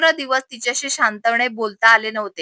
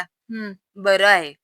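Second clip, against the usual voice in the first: low, reluctant hmm and curt approval; grudging; 3 semitones lower, 3 dB quieter, much slower than usual. A narrator's reading. Aunt reluctantly approves of the meal, grudging. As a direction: concede it sourly, pitched down. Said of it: "तोंड कडू करत एवढंच म्हणाल्या".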